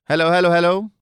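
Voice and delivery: loud, ringing call to open the mic check; calling out hello. Real, not acted. Teacher opening an online class, checking the mic, calling out loudly.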